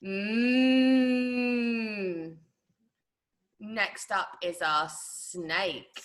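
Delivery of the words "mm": long closed-lip moo; sustained humming moo; cow moo imitation. Shown as a demonstration. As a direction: hum a moo through closed lips. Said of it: "If you keep your lips closed".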